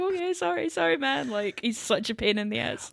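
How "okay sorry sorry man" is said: meek, high, softened apology; Randall. Retold or imitated. Scolded captive apologising to his captor, meek. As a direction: back down quickly in a small high voice. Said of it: "And Randall's like".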